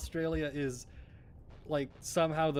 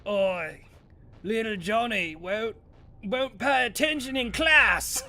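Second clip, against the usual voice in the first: loud, higher, slow Aussie Karen yell; rough Australian Karen; 6 semitones higher, 6 dB louder, slower than usual. Retold or imitated. Australian Karen complaining about Johnny, loud Aussie bark. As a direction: bark it like a gravel-pit Australian mum. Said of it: "sound like they work in a gravel pit".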